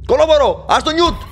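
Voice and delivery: high, startled shouts; alarmed yelling. Real, not acted. Player yelling at baby to leave Newt alone, alarmed shout. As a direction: yell out in sudden alarm.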